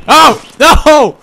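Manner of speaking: high, loud cry of dismay; dismayed shout. Real, not acted. Gamer seeing his head get stuck, shouting in dismay. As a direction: yelp at the disaster.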